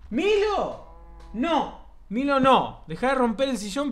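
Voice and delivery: loud high scolding; scolding the pet. Real, not acted. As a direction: shout no at a naughty pet.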